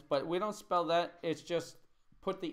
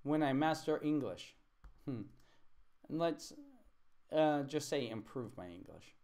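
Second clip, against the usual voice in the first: usual speaking voice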